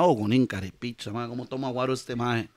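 low, soft thinking-to-oneself voice; pensamiento en voz baja. Acted. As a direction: voice a private thought, low and quiet. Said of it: "porque el mae tal vez pensaba". (pensando para sí, grave y bajito)

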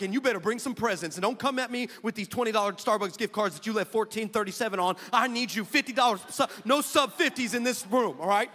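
hammed-up bossy pastor; mock bossy demand. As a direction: lay down the law jokingly. Demanding presents.

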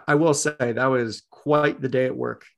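ordinary talk, nothing marked

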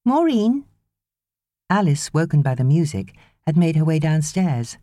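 put-on high, small child voice; child calling for the nanny; little girl. A narrator's reading. Young girl calls.

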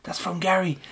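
hushed, secretive aside to a dog; conspiratorial whisper. Retold or imitated. Gary sneaking ham to the sniffer dog, whispering. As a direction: whisper it as if slipping a secret treat.

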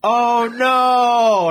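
loud, high, drawn-out boss's dismay; hammed-up long "oh no"; mock dismay. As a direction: wail it as an imitated horrified boss. Boss reacting, voiced in a story.

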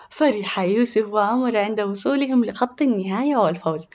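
plain reading voice

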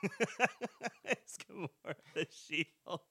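slow, laughing through the question; laughing reread. Real, not acted. Rereading the Google question while laughing. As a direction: giggle out the silly search result.

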